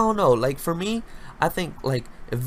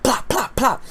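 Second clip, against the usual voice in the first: gunshot sounds; 11 semitones higher, 4 dB louder, slower than usual. Retold or imitated. Imitating gunshots at someone's house.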